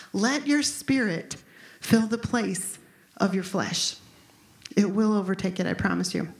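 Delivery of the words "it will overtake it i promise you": usual speaking voice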